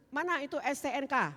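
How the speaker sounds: retold demand, high and loud; angry demand. Driver wants the car registration, demanding angrily. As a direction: imitate a forceful demand for papers.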